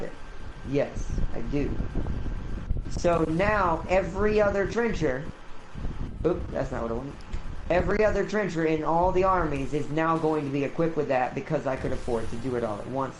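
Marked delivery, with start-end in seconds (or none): none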